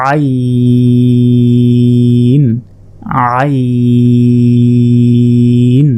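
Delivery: আইন held very long from mid-throat, four alif long; drawn-out Arabic letter. Shown as a demonstration. Teacher models a four-alif আইন for students, very slow. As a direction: stretch আইন slowly, voiced from the middle of the throat. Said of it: "হলকের মধ্যভাগ হতে".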